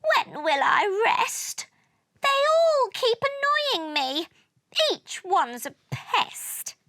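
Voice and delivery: high-pitched, put-upon whine; whimpering complaint. A narrator's reading. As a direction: whimper as if endlessly pestered. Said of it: "whimpered Jupiter".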